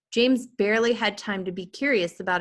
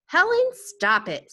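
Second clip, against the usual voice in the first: sharp, louder, higher snap; snapping; 10 semitones higher, 6 dB louder than usual. Acted. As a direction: bite the words off angrily. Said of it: "snapped his father".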